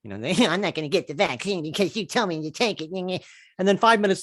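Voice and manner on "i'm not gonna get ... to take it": put-on a sulky child's whine, a touch softer; mock a pouting child; whiny kid voice, not too broad; petulant child. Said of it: "don't act like a petulant child".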